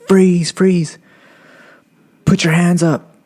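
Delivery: high-pitched cop ordering a suspect; mock police order; imitated cop command. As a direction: call it out like a cop, pitched well up. Acting the cop giving orders.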